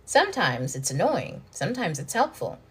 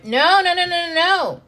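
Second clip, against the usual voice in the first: higher, louder string of quick "no"s; emphatic no; 10 semitones higher, 5 dB louder than usual. Real, not acted. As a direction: wave off the thought, much higher. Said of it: "cuts herself off".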